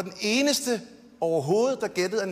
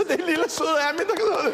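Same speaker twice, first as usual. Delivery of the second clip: high, put-on gushing voice; kælent efterlignet begejstring; 7 semitones higher than usual. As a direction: coo it high, mocking the adoring family. Same mock cooing voice, about himself. (hånligt sødladen, lys stemme)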